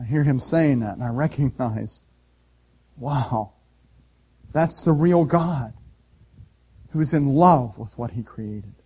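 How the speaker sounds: normal talking voice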